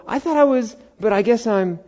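high, uncertain voice that falls apart; mock sudden doubt; deflating realization. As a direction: catch yourself mid-boast. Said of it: "We can go".

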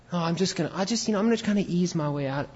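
high, soft, quick lazy excuse; mock a sinner dragging his feet; mock reluctant sinner.

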